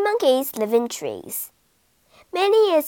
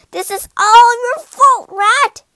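higher, louder furious yell; angry shout; 11 semitones higher, 9 dB louder than usual. A narrator's reading. Cat blaming the rat, angry shout. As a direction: blame her at full volume.

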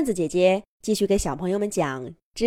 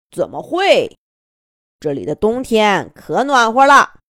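put-on kindly old grandpa bird; 7 dB louder than usual. Grandpa Domi answers the birds.

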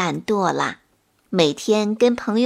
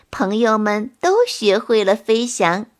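ordinary delivery, nothing marked

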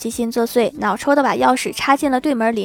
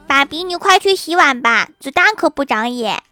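put-on higher-pitched child voice, playfully threatening; little daughter's voice; 3 semitones higher, 3 dB louder than usual. Child aims toy gun at dad, playful threat in kiddie voice. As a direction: pipe up like a small child giving orders. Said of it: "郭晓霞看了一下她爸的眼神".

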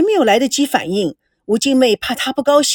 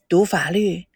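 put-on an elderly grandfather probing slowly, lower; old grandfather; 4 semitones lower than usual. A narrator's reading. Grandfather questions granddaughter's boyfriend, slow and low.

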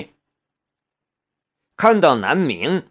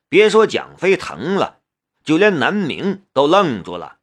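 plain reading voice

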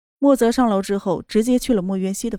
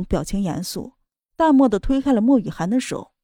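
ordinary delivery

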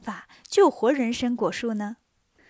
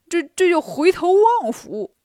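Bajie clowning; 5 semitones higher, 6 dB louder than usual. Acted. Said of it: "八戒说".

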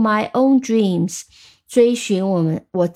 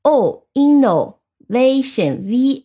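slow spelling in two halves; much slower than usual. Shown as a demonstration. Teacher spells innovation with learners, spelling out slowly. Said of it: "那我们拼一下".

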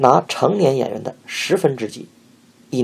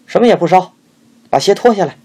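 high, loud curt command; cold order; 8 semitones higher, 7 dB louder, faster than usual. A narrator's reading. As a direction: order him coldly. Mistress orders the boy.

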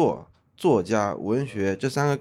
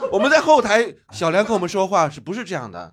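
laughing loudly; 8 semitones higher, 5 dB louder than usual. Real, not acted. Laughing, recalling Xiao Liang backstage.

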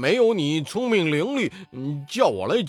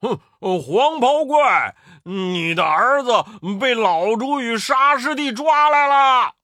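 stern shout; 8 semitones higher, 8 dB louder than usual. A narrator's reading. Pigsy on a cloud yelling a taunt at the Yellow Robe demon, loud. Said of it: "厉声高叫道".

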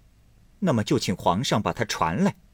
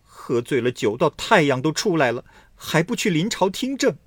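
loud, high furious scolding; furious outburst; 8 semitones higher, 5 dB louder than usual. Acted. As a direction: scold the emperor in a rage. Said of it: "太真大发脾气着说". (大发脾气地高声训斥)

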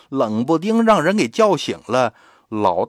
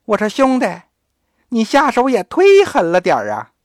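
音调抬高，用孩子的嗓音说大人话; 稚气的童声带点埋怨; 小孩儿声; 8 semitones higher, 5 dB louder than usual. Acted. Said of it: "他是小孩儿的声". (孩子嗓音里说出大人的抱怨话)

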